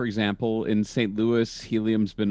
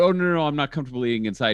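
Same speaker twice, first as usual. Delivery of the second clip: rushed flustered refusal; 4 semitones higher, much faster than usual. Retold or imitated. Retold refusal to friend.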